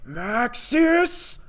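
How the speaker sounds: put-on high, loud German-accented cry; shouted name with a hammed-up German accent. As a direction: call it out in a bad German accent. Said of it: "That was my German accent".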